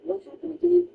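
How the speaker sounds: pressing question with a sharper edge; repeated demand. Retold or imitated. Hospital staff grilling the parents, insistent. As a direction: keep pressing for the story. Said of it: "they will ask you multiple times".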